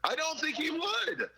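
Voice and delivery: high, drawn-out protest. Real, not acted. Podcast co-host rejecting the claim about a friend, protesting. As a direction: object slowly in a high-pitched voice.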